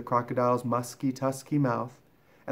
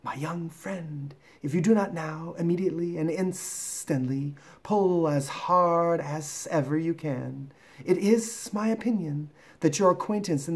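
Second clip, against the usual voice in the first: python rock snake; 5 semitones higher than usual. Acted. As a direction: draw out the words like a stately serpent. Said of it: "This is the way bicolored python rock snakes always talk".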